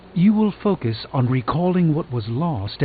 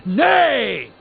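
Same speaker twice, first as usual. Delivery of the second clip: defiant shout; 10 semitones higher than usual. Retold or imitated. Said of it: "I shall stare those cosmic forces".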